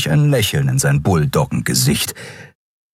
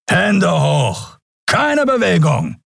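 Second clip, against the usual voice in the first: shouted command; 10 semitones higher, slower than usual. Acted. Guards surround and order fugitives.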